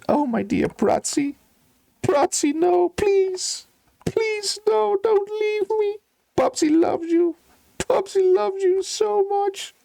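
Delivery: high wailing plea over his dying bird; sobbing steward. Acted. Steward weeping over his dying bird, high wailing. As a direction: wail high, begging through tears. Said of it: "falls in tears on the floor".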